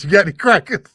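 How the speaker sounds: laughing through punchline. Acted. Friend telling a joke, laughing at his own punchline. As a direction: deliver the punchline while breaking into laughter. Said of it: "Duck says".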